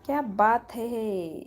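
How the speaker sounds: slow, stretched-out exclamation; drawn-out. Real, not acted. Live streamer repeats her remark about the trolling woman, drawn-out. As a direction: linger over each word.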